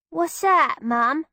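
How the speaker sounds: put-on Cockney girl. Peggy answers in Cockney.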